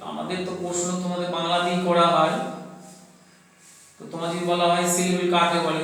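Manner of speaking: ordinary talk, nothing marked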